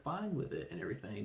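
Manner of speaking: usual speaking voice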